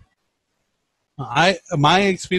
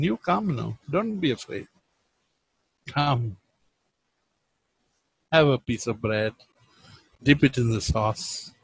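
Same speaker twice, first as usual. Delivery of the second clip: soft, coaxing and much softer; tender invitation; 4 semitones lower, 6 dB quieter than usual. Retold or imitated. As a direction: gently reassure a shy guest. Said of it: "his invitation".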